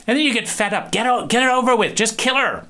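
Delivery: exasperated viewer begging the movie to get on with it; fed up. Retold or imitated. Impatient viewer yelling at the horror film, exasperated.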